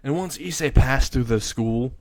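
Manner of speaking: ordinary delivery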